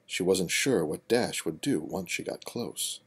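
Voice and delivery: plain reading voice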